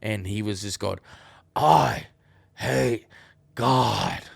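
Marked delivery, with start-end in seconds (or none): 1.5-4.2 loud barked I hate God. Retold or imitated. Stranger in the street barking, loud mock bark. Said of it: "Just barking it".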